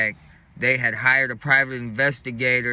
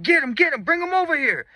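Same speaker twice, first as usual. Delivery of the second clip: very high mock holler; imitated chase yell; 13 semitones higher, faster than usual. Party dude after the runners, imitated high-pitched hollering. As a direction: order the crowd to seize him.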